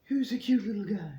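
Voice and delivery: put-on high, loud cooing baby talk. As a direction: coo it like talking to an infant. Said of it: "in that dumb baby talk voice".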